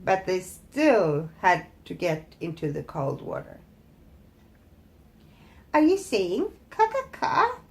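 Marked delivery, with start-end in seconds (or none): 5.7-7.6 high sneering imitation of caws; mock the geese cruelly. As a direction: witch mimicking goose calls, jeering. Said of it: "she mocked them".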